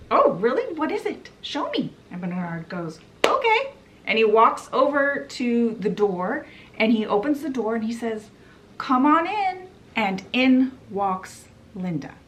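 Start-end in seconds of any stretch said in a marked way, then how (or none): 0.1-1.9 high-pitched, sceptical retort; imitated disbelief, pitched well up; mock challenge. Boss challenges Bernard in retold scene. 3.2-3.7 acted-out reply, very high; light, high okay in a retold scene; high-pitched okay. Bernard takes up the boss's challenge. 8.8-9.7 high, sing-out invitation; lightly acted high call; calling someone in. Bernard calls Linda in at door.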